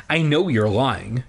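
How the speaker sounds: plain reading voice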